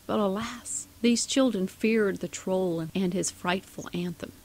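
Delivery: usual speaking voice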